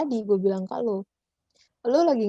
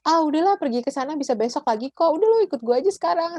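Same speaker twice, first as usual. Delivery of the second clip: pushy friend; 7 semitones higher, 4 dB louder, faster than usual. Retold or imitated. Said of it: "Kalau temennya ternyata gini".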